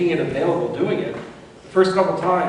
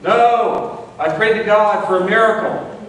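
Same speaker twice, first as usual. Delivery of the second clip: high, loud stubborn refusal; yelling back; 7 semitones higher, 5 dB louder, slower than usual. Retold or imitated. Stranded man yelling back at the rescuers, high loud refusal. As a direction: shout it back, sure of the miracle. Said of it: "the man yells back".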